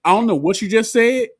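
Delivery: high, loud, comic retort; loud mock bafflement. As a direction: imitate a wrestler's blunt comeback. Acting out wrestler's puzzled comeback.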